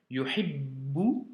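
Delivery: slow yuhibbu, holding the doubled b. Shown as a demonstration. Teacher showing the shedda, yuhibbu with doubled b. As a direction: hold the bb in yuhibbu, then release a long u. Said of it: "c'est doublé".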